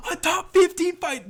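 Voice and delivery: slow, very high, loud yell; losing his mind. Real, not acted. Fan about to lose his marbles, slow outraged yelling. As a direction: stretch out an outraged shout. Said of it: "I'm really gonna fucking lose my mind".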